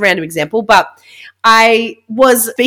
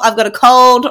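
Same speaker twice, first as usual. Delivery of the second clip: ordinary talk, nothing marked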